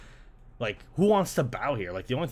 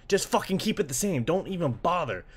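usual speaking voice

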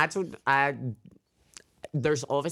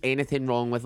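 usual speaking voice

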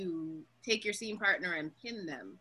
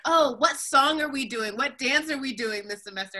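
excited students; 6 semitones higher, 9 dB louder than usual. Retold or imitated. Students asking every semester.

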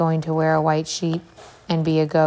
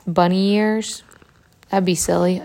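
usual speaking voice

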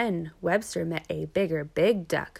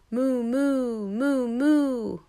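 long, loud, raised cow moos; mooing; 6 semitones higher, 5 dB louder, much slower than usual. A narrator's reading. Bigger big duck helps call mother, long mooing. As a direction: moo it out in swelling waves. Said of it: "mooed the bigger big duck".

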